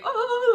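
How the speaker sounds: high, loud "ohhh"; a mad howl way up high. Retold or imitated. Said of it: "like, mad".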